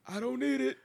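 high, loud cartoon quote; SpongeBob. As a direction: put on a high, strained cartoon voice. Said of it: "quoting SpongeBob".

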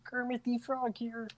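high puppet frog voice; high-pitched frog impression; Kermit. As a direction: announce yourself as the famous frog puppet. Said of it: "my Kermit impersonation".

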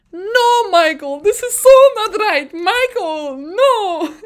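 high, loud, drawn-out protest; Toto Wolff. Retold or imitated. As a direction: impersonate an outraged team boss on the radio. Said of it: "my Toto Wolff is kind of good".

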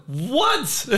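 high squealed surprise; hammed-up astonished yelp; mock disbelief. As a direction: shoot the question up high, incredulous. Acts out disbelief at announcement.

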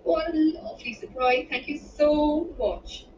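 high, gushing thanks; put-on delight, pitched high and drawn out; mustered enthusiasm. A narrator's reading. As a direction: pump up the enthusiasm, slowing on the thanks. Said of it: "injected as much enthusiasm as she could muster".

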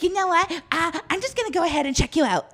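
mock a huffy clerk, quick and high; snippy clerk. Clerk threatening checkout, high huffy mock voice. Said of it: "he's like".

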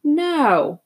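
a stunned, drawn-out refusal to believe it; dumbfounded. A narrator's reading. Friend hearing the boy's outburst, dumbfounded.

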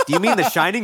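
high cartoon kid voice; Bart. Cartoon boy correcting the groundskeeper, mock puzzled. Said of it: "and he talks to Bart".

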